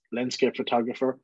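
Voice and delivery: ordinary talk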